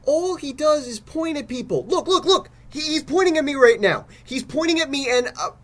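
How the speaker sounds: high, rising panic; rattled and panicking; panicked. Acted. Host panics as Death points at him.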